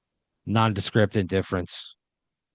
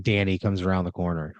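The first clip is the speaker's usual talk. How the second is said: ordinary delivery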